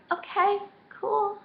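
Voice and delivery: high, drawn-out acceptance; coy agreement. Retold or imitated. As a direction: say it high and slow, playing it cool. Acted reply, told to text him.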